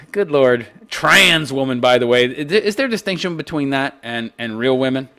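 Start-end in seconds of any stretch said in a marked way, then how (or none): none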